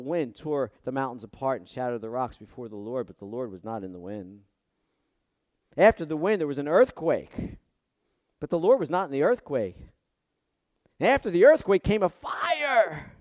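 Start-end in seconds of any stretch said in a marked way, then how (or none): 5.8-13.1 proclaimed. Shown as a demonstration. Preaching Elijah's wind, quake, fire.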